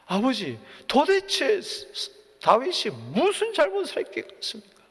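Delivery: high, strained plea, stammering; pleading son. Retold or imitated. Jonathan pleads with his father Saul, high strained plea. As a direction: beg the father, voice climbing high.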